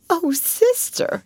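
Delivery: high, slow tearful wail; sniffling. A narrator's reading. Miss Amelia to her sister, tearful. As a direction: whimper through tears. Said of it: "she sniffed".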